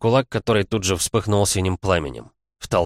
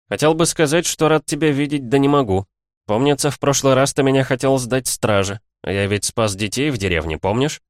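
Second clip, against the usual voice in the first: taunting; 7 semitones higher, 4 dB louder than usual. A narrator's reading. Bearded man taunting the hero.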